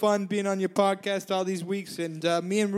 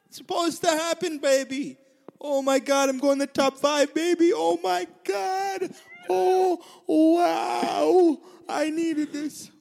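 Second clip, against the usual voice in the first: much higher, laughing, ending in an excited shout; overjoyed; 6 semitones higher, much slower than usual. Real, not acted. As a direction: burst out with disbelieving joy. Overjoyed at making top five.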